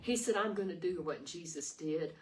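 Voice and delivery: ordinary delivery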